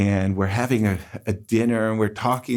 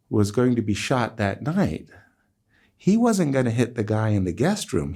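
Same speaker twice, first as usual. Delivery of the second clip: ordinary talk, nothing marked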